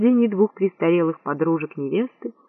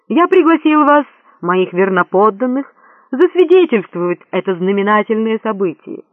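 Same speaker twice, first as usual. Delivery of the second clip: grand announcement; 3 semitones higher, 5 dB louder than usual. A narrator's reading. Prince to his assembled subjects, proclaiming. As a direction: address loyal subjects grandly. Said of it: "объявил Горбуль".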